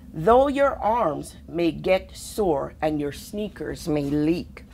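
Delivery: plain reading voice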